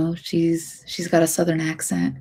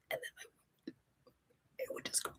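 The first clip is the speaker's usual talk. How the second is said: voice cutting out; 25 dB quieter, much faster than usual. Shown as a demonstration. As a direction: whisper fading into silence. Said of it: "And that's what would happen".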